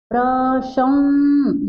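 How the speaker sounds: प्रशं- stretched slowly into a long nasal hum; very slow teacher's demo of प्रशं-, "pra-shummm"; correct nasal pronunciation. Shown as a demonstration. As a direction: draw out the nasal at the end of प्रशं-. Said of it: "इसका शुद्ध उच्चारण क्या होगा".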